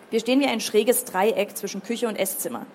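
ordinary delivery

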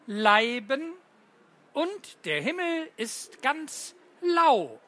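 put-on slow, lilting riddle reading for children; poem recital. Teacher reads riddle poem to the class, lilting and slow. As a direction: read the rhyme out with gentle pauses.

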